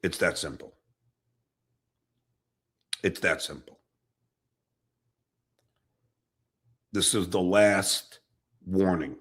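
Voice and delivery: low, measured final warning; stern warning. Real, not acted. Streamer warning Vince for the last time, stern and controlled.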